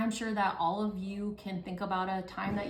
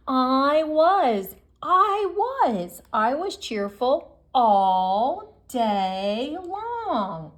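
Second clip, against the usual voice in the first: much higher, slow sing-song joy; cheerful ending; 4 semitones higher, 8 dB louder, much slower than usual. Acted. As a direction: stretch it out beaming. Happy ending of story.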